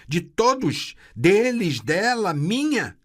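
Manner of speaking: loud cruel scorn; furious mocking voice. A narrator's reading. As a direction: hurl the mockery. Metallic voice mocking loudly.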